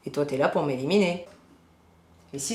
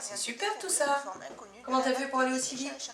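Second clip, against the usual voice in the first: impressed; 10 semitones higher, 7 dB quieter than usual. Real, not acted. Delighted by renovation work.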